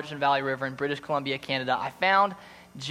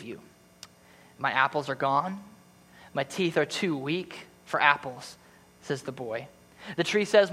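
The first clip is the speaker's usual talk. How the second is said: story narration; 4 semitones higher than usual. A narrator's reading. Pastor reading The Giving Tree to church, tender storytelling. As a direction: read it like a bedtime story to the congregation.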